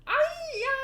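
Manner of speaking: very high, loud shriek into a wail; pained shriek. Acted. Clown getting pinched, a high loud shriek. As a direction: scream at the pinch.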